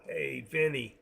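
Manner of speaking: hushed mob-movie tough guy; wise guy. Acted. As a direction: play a wise guy quietly giving orders. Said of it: "I love the way they talk".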